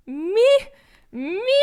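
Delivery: high, loud incredulous "Me?"; mock disbelief. To colleagues praising her, a high, loud incredulous question. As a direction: pretend you can't believe the praise. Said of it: "I'm like".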